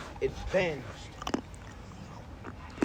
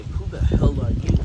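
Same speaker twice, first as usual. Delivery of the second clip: louder, a rival yelling Who the hell are you; loud challenge; 10 dB louder than usual. Retold or imitated. Kisei confronts the newcomer on the field, loud mock yell. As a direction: bark it at the stranger. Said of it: "Kisei would say".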